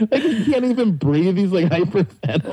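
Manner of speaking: usual speaking voice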